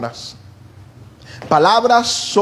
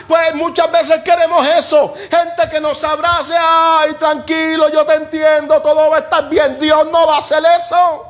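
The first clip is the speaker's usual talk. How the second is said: fiery preaching; 11 semitones higher than usual. Acted. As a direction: preach it to the congregation with fire. Preaching at full force. (predicación enérgica y apasionada)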